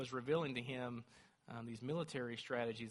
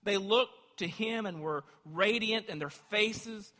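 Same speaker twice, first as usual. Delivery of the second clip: full-force preaching; 5 semitones higher, 8 dB louder than usual. Acted. Preacher reading Psalm thirty-four to church, loud fervour. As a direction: proclaim it to the rafters.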